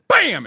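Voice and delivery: loud high bam; crash sound. Retold or imitated. Retelling the rear-end crash with a loud high bam. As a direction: smack out the crash noise. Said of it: "Lady goes".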